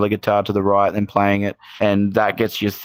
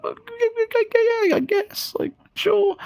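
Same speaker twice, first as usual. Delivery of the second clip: very high mock-nervous squeak, higher than his own voice; squeaky nervous yes; slower than usual. His younger self answering [name], squeaked out in a very high, nervous mock voice. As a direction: imitate yourself squeaking with nerves, hardly daring to agree. Nervous agreeing to famous composer.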